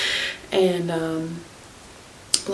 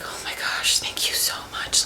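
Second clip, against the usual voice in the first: grateful whisper; 4 dB quieter than usual. Retold or imitated. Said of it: "And she's like".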